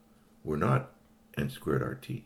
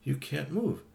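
sympathetic; 11 semitones higher, slower than usual. Acted.

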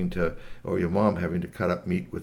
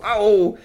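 loud, high drawn-out howl; hammed-up yelp of pain; cry of pain; 10 semitones higher, 9 dB louder than usual. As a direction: howl over a stubbed toe. Kicked his toe.

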